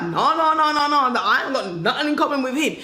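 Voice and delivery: insistent, rapid-fire mock denial; mock protest. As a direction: rattle off a hasty objection. Acting out a protesting listener.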